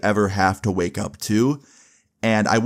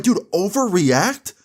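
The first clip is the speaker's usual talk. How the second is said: incredulous scoff; 10 semitones higher, slower than usual. Retold or imitated. Narrator scoffs at Morgan's complaint.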